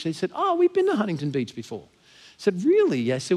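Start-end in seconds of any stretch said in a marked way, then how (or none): 0.3-1.8 higher-pitched Kiwi imitation; New Zealand accent. New Zealand couple answering where they have been, imitated Kiwi accent. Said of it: "they're Kiwis, by the way". 2.6-3.1 high-pitched retold "really"; surprised disbelief. Traveler hearing they had been to her hometown, surprised.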